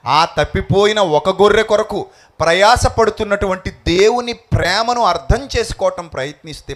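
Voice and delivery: climbing into full preaching; rising sermon. Acted. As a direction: push the appeal out to the congregation.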